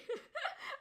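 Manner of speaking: squeaky high giggle burst; high laugh. Real, not acted. Streamer to chat, a sudden high giggle.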